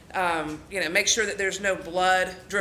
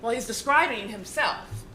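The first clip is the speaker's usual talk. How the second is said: ordinary delivery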